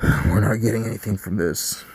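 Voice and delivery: normal talking voice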